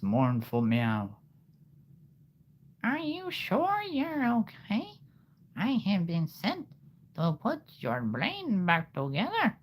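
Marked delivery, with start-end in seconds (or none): 2.8-9.6 very high, slow cat voice; high, drawn-out, cutesy cat voice; cat character. Acted. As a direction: squeak it out like a concerned kitty. Cat character questions Isabella.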